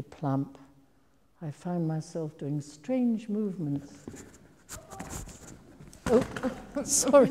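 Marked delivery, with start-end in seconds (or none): 6.1-7.3 breaking into laughter, apologising; laughing. Real, not acted. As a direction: laugh it off, high and loud. Laughing apology mid-story.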